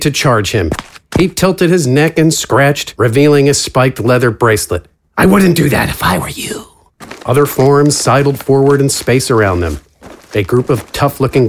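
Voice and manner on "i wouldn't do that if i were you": higher, smug warning voice; menacing villain. Acted. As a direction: warn her with a smirk. Villain warns her.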